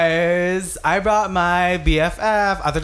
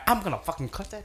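muttered threat; 10 dB quieter, faster than usual. Retold or imitated. Retold murderous thought.

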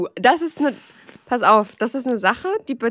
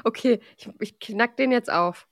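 ordinary delivery

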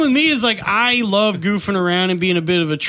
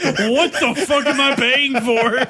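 slow, mock-indignant complaint through laughter; mock angry listener; 4 semitones higher, slower than usual. To the hosts, a subscriber's mock outrage. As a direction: play a fed-up subscriber, slowly. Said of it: "it's like".

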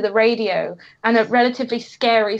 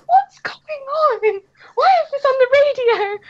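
panicked; 12 semitones higher, 4 dB louder than usual. Retold or imitated. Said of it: "and I was like".

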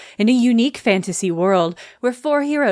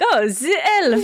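hammed-up French accent, a bit higher; bad French accent; 5 semitones higher than usual. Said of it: "like a bad French accent where you're like".

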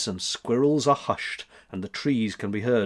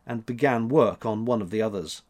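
ordinary delivery, nothing marked

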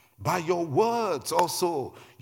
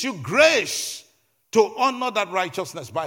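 loud, high, driving sermon delivery; raised-voice preacher building with insistence; impassioned preaching; 7 semitones higher, 6 dB louder than usual. Real, not acted. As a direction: preach it at full force, punching each phrase. Preaches at full force.

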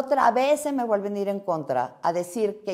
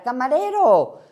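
imitating a raised, higher call; retold shout; 6 semitones higher, 6 dB louder, slower than usual. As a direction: call it out louder, as a retold cry. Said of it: "Y es donde grita".

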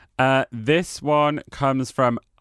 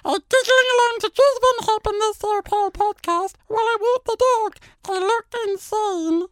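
very high squeaky helium voice. Shown as a demonstration. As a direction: squeak every word like helium. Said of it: "Should I do it again in the helium voice?".

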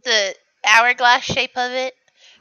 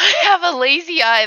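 higher, louder mimicry of a lame excuse; put-on, higher-pitched mocking echo; 6 semitones higher, 6 dB louder, faster than usual. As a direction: parrot the feeble cover story, pitched up and loud.

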